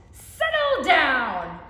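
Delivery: slow, loud roar. Acted. Monster teacher roaring at her class, loud and slow. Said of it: "Missus Kirby roared".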